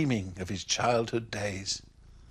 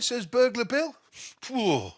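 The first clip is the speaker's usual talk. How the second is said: plain reading voice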